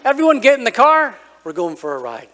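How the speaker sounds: parent calling the family. Retold or imitated. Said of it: "for my parents to say".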